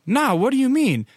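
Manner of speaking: high-pitched acted-out confusion; high, retold bewilderment; puzzled reply. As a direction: imitate a baffled stranger answering.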